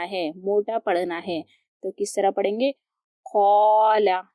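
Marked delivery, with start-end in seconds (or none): 3.3-4.2 कौला with a heavy "qau" and the alif held one count; heavy qaf drawn. Shown as a demonstration. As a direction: fill the mouth on कौला and stretch the alif. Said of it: "एक अलिफ के बराबर खींचेंगे और पुर पढ़ेंगे".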